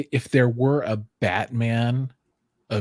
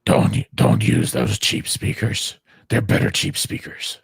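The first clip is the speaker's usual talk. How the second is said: Batman; 12 semitones lower than usual. Acted. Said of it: "you like my Batman voice?".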